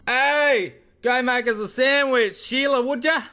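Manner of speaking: high, loud hammed-up Aussie bogan holler; bogan yell. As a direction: yell a mock order across the house, bogan style. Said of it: "When he gets home".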